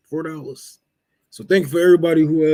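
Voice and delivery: ordinary talk, nothing marked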